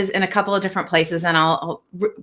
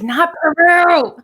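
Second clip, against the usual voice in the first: slow, higher, louder "Not Peru"; emphatic correction; 9 semitones higher, 5 dB louder, slower than usual. Retold or imitated. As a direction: correct them firmly, stretching each word. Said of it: "She's like".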